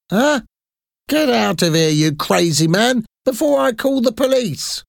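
flower seller threatening to call the police; angry old lady. A narrator's reading. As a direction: shoo off a crazy man with disgust. Flower lady chases him off.

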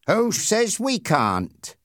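slow, sneering challenge with a smile; nasty smirk. Acted. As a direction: taunt him, grinning. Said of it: "with a horrible smile".

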